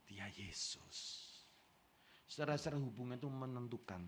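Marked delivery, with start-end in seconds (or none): 0.1-1.3 awed hush. Retold or imitated. Yohanes recognising, awed.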